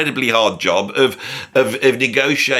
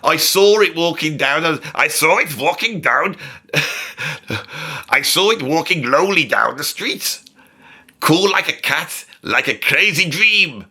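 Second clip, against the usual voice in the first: badly faked German accent, higher pitched; 4 semitones higher than usual. Comedian reading lyrics as the singer, rubbish German accent. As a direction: attempt a German accent and miss. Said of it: "This is a rubbish accent".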